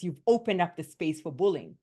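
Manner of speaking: usual speaking voice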